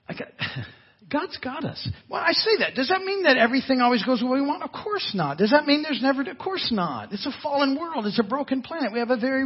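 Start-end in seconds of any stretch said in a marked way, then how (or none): none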